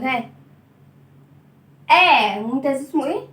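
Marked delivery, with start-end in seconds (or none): 1.9-2.4 "آ" as one loud open vowel; letter's bare sound. Shown as a demonstration. Teacher giving children the sound, not the name, a loud "آ". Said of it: "عايزة صوته على طول".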